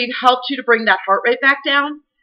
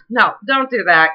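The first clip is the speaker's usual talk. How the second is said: ordinary talk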